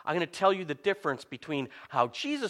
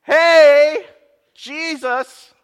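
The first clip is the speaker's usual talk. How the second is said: loud, high holler to wake someone; acted-out yell, drawn out and raised in pitch; shouting; 11 semitones higher, 11 dB louder, much slower than usual. Disciples waking Jesus in the storm, shouting. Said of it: "They shouted at him".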